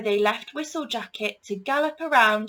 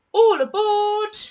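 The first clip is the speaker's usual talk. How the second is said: loud, slow call of a train driver; 12 semitones higher, 10 dB louder than usual. A narrator's reading. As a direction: call everyone onto the train. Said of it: "said the driver".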